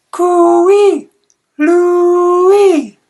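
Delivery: long high call across the yard. A narrator's reading. Amy calling Louie across the yard, a long high call. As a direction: sing it out loud to a friend far off. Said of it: "she called him across the yard".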